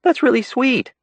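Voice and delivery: put-on a girlfriend charmed by the gesture; high, tender thanks; Sarah's voice, touched. A narrator's reading.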